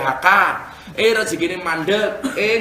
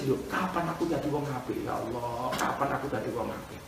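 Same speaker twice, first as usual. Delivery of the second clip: low, hushed lament; soft plea; 6 semitones lower, 11 dB quieter than usual. Acted. Preacher reads his own plea to God, soft lament. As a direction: murmur it low, like a private prayer.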